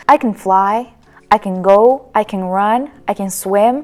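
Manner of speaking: "can" weakened to "kn", verb carries the stress; unstressed "can". Shown as a demonstration. Teacher gives example statements, weak "can". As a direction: throw away "can" and land on the verb. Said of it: "They don't emphasize it".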